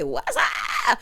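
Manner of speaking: drawn-out mock radio holler; catchphrase shout. Host quoting sitcom radio catchphrase, drawn-out shout. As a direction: holler it like a radio host's signature call. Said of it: "working at What's Up Radio".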